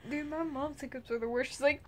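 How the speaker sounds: normal talking voice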